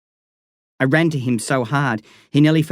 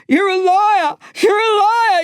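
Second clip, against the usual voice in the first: repeated scream, far higher and louder; boy screaming at the gate after Lyle; 5 dB louder than usual. Acted. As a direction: scream liar again and again. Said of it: "I was screaming so loud it hurt my throat".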